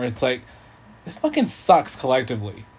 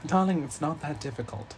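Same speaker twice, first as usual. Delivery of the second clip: posh British "Darling", softer; a hint of a British lady; British accent; 3 semitones higher, 6 dB quieter than usual. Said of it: "but [name] can".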